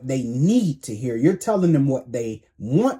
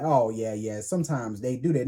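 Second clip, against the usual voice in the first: lower, softer lazy shrug of a voice; mock unbothered God; 5 semitones lower, 5 dB quieter than usual. God on the throne shrugging off sin, lower and softer.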